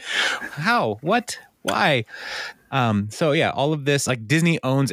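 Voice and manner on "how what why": baffled disbelief. Real, not acted. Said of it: "And it's like".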